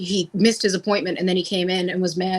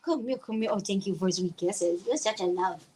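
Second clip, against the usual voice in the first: pet talk; 5 dB quieter than usual. Real, not acted. Playing with the dog now.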